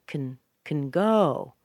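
short low "kn" before a long "go"; slow demo of reduced "can" before "go"; low, small "can". Shown as a demonstration. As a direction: drop "can" down, swell on "go". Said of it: "The intonation's very low".

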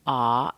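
"ah" said open like father; the O in John as ah; short O as ah. Shown as a demonstration. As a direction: say "ah" open and long. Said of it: "It's pronounced as ah".

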